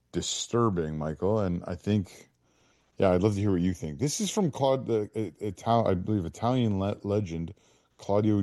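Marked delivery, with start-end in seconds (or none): none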